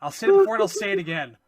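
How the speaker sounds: ordinary talk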